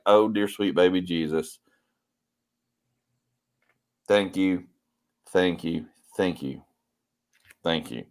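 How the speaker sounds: hushed gratitude dwindling away; mock grateful awe. Stepdad thanking stepdaughter for the candy, in hushed mock gratitude.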